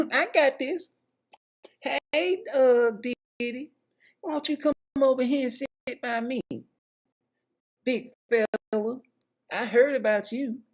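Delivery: mock seductive woman. Woman flirting with the birthday host in a high coo.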